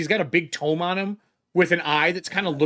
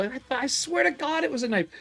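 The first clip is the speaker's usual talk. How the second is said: surprised; 8 semitones higher than usual. Acted. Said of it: "He flips it back around".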